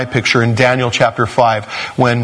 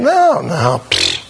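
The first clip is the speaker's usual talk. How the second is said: dismissive scoffing; slower than usual. Acted. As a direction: wave the warning away. People scoffing at warned judgment.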